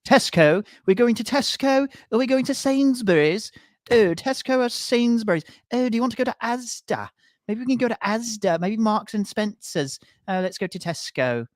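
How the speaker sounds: high-pitched mock English accent; mock British shopper. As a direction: prattle about supermarkets in a hammed-up British accent. Mocking British shopper chatter.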